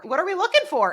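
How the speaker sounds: lightly acted coworker asking a question; mock question. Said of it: "Eric says to Harper".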